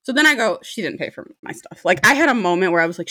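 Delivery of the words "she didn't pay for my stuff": under-breath thought. Retold or imitated. Inner thought at the bill.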